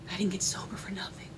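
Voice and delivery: hushed near-silent whisper; emotional whisper. Real, not acted. Recovering mother whispering to viewers about sobriety. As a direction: let it slip out under your breath.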